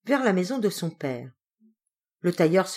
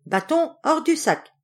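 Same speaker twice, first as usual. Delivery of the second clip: raised, firm command to the stick; commanding call; 10 semitones higher, 3 dB louder than usual. Acted. The young journeyman to the stick, firm raised command. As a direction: order the stick out, firm but not yet yelling. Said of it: "il disait".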